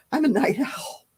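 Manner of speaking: sighing through a yawn. Real, not acted. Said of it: "I'm yawning".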